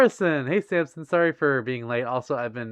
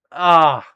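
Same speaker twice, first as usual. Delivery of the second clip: pained yelp; 3 semitones higher, 14 dB louder than usual. Real, not acted. Reacting after saying swear.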